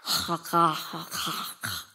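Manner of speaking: low, soft panting ha sounds of a feeding bear; mock bear chewing its catch; bear eating sound. Shown as a demonstration. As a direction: breathe out short ha's like a bear devouring salmon. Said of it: "there's this sound that's coming out of his mouth".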